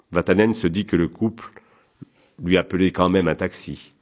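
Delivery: plain reading voice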